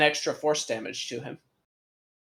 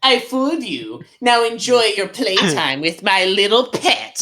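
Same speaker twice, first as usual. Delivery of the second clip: gloating villain, higher and louder; mustache twirling villain; 6 semitones higher, 7 dB louder than usual. Acted. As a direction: cackle out a villain's triumph.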